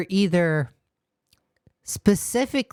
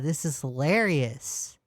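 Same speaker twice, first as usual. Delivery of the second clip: usual speaking voice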